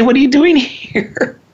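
high, slow mock-stern mom asking what's going on; mother catching kid home. Mother finding her son home from school, mock-stern and high. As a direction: put on your surprised mother's voice, up high and slow. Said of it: "And she'd say".

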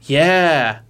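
slow, spaced-out stoner drawl; burnt-out hippie. Retold or imitated. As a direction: lazily agree like a mellow hippie. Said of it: "the T-Rex sounds like a burnt-out hippie".

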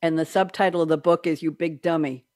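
normal talking voice

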